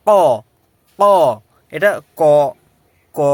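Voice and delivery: ক said deep from the throat, a pushed q sound; deep throat qaf. Shown as a demonstration. Arabic teacher drilling ক from deep in the throat. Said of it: "উচ্চারণটা হবে আপনার একদম ভিতর থেকে".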